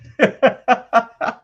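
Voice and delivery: loud high chuckles in bursts; loud laugh. Real, not acted. Streamer laughing loudly at a chat comment, choppy laugh.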